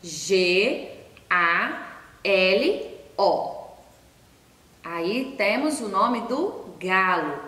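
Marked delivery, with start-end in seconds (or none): 0.0-3.6 very slow, each letter apart; letters stretched one at a time; spelling letter by letter. Shown as a demonstration. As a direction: spell it out for small children. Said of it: "Vamos escrever juntos?".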